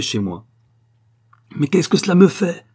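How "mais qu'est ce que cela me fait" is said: loud, high burst of impatience; raised-voice, impatient question; exasperated cry. A narrator's reading. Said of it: "cria Sir Hughes".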